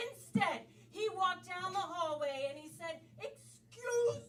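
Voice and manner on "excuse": mock exasperated complaint, drawn out; hammed-up indignation; indignant outburst. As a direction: play up the outrage to the staff. Acted complaint to absent staff.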